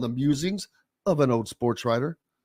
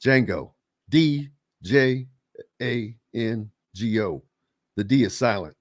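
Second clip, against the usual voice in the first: spelling out; 5 semitones lower than usual. Retold or imitated. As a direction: spell it letter by letter with spaced pauses, voice sinking low. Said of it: "he spelled his name to a guy in one of the scenes".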